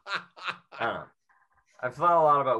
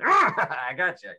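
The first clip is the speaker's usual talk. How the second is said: louder, drawn-out cackling pounce; gleeful gotcha villain; 3 semitones higher, 7 dB louder, slower than usual. Retold or imitated. Covid catches line-crosser, loud cackling gotcha. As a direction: pounce slowly with a laugh, as the virus. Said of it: "covid would have just been".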